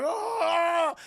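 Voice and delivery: high, loud anguished wail; pained cry. Retold or imitated. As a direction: cry out in distress. Crucified girl crying out.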